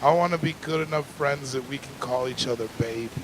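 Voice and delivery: ordinary delivery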